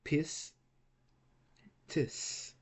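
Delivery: Pis, tis as two beats with a long pause between; isolated syllables Pis, tis. Shown as a demonstration. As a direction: break after the s in Pis, then tis. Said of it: "It will be two syllables".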